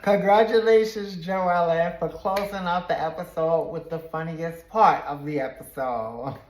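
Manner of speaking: loud, high, laugh-filled cheer; amused, laughing congratulations. Real, not acted. Recapper to Joellen after the funniest part, amused laughing congratulations. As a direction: congratulate her while still getting over a laugh.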